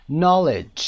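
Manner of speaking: no K at the start of knowledge; silent K. Shown as a demonstration. Teacher modelling knowledge for the class. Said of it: "صدای K رو نمیشنوید".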